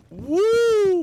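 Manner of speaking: loud, very high whoop; shrieking woo. Real, not acted. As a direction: let out a wild whoop at the punchline. Reacting to the story climb.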